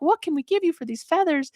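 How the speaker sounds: high, eager storybook couple; acted grateful eagerness; eager offer. Couple offering old woman a trade, eager.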